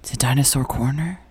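hushed eager question; whisper. Acted. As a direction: whisper it like a secret. Whispered segment cue.

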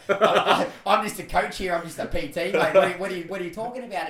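loud, high-pitched retold disbelief; baffled protest. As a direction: protest loudly, as if the caller has the wrong person.

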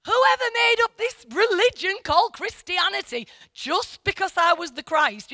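mock complaint, starting high and easing down; Jesus, exasperated. Preacher voicing Jesus on Christianity, mildly hammed-up exasperation. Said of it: "I often think that Jesus is going".

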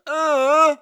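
mock playground grunt. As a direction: grunt it out with tongue pushed under the bottom lip. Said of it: "you put your tongue under your bottom lip and you go".

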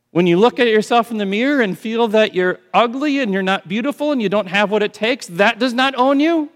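high, loud preaching climax; fiery preacher build; preaching at full force. Acted. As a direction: proclaim it over them, ringing out.

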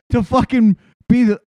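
ordinary talk, nothing marked

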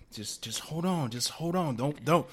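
urgent encouragement. Retold or imitated. Partner urging her through surgery, intense encouragement.

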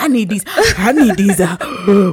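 rising impassioned oratory; preacher voice. Pretend. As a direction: build it up like a preacher. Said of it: "That's my preacher voice".

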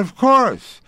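high, emphatic yes; eager acceptance. Retold or imitated. Young actor accepting a radio job, eager. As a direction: jump at the offer, voice leaping up.

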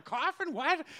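mock surprise. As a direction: pretend you've never heard of it. Feigning surprise at the coffin.

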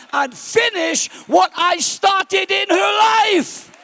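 high, loud, slowing declaration; triumphant shout. Acted. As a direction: proclaim victory over the devil. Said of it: "Grace says to the devil".

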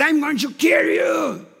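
a hint of a demonic threat; possessed woman threatening. Possessed woman threatening the preacher, mock menacing. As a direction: threaten him coldly. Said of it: "she said".

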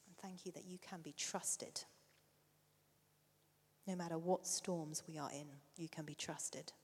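soft, hushed prayer voice; quiet prayer. Acted. Preacher leading the church in quiet prayer. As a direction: pray it softly to the congregation.

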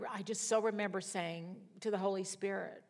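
ordinary talk

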